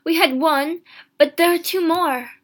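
high, loud insistence; excited cry. A narrator's reading. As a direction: explain it in frantic hope. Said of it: "she cried".

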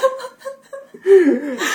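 high-pitched burst of laughter. Real, not acted. Laughing at AI music joke.

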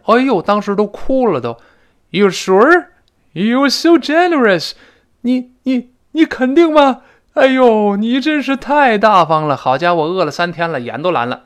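hammed-up overjoyed near-tears, high and loud; tearful disbelief. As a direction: wail it as if moved to tears by generosity.

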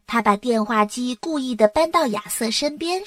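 plain reading voice

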